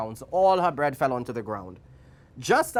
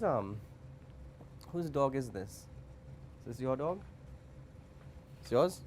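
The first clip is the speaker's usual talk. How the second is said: quiet off-the-cuff aside; offhand aside; 8 dB quieter than usual. Real, not acted. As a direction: step out of the story and speak quietly, casually. Breaks off to audience.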